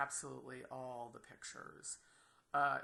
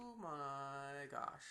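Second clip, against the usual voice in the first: stunned; 4 dB quieter, much slower than usual. Real, not acted. Stunned by form field list.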